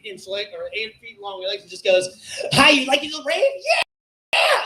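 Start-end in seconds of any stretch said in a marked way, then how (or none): 2.5-4.6 high, loud goblin voice; goblin man. Retold or imitated. Comic imitating a goblin man at a rave, shrieking. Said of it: "goblin man steps out".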